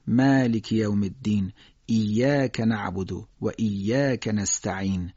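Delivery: measured Quranic recitation with a thin, fronted long "a" in "مالک"; careful Arabic demonstration reading; light alef recitation. Shown as a demonstration.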